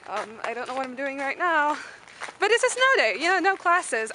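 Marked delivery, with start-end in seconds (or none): 2.4-4.1 higher and louder, thrilled about the snow day; bubbling over at no classes; excited. Real, not acted. Student telling viewers about the snow day, excited.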